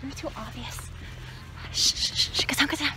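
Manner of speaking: hushed shushing, get down; urgent whisper. Pretend. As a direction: whisper so nobody hears. Hiding from friends in maze.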